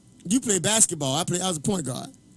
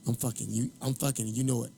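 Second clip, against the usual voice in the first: low, softer re-enacted taunt; clinch trash talk; 7 semitones lower, 8 dB quieter than usual. Boxer to his opponent in the clinch, low taunting. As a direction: taunt quietly, as if up close.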